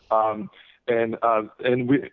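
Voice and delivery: ordinary talk, nothing marked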